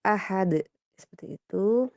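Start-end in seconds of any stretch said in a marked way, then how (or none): 0.1-0.6 "ahad" ending at once, no held dal; direct dal release. Shown as a demonstration. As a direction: release "ahad" straight away. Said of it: "langsung aja". ("ahad" langsung, tanpa ditahan)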